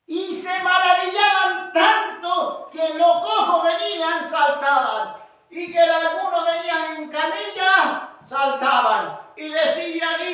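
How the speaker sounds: loud, high preacher's cadence with drawn-out phrases; full-force preaching. Real, not acted. Preacher telling how the lame leapt and cried out, full-force preaching.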